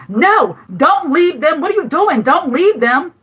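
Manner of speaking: retold yell. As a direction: relive yelling at him not to leave the kids. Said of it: "So I yelled at him on the phone".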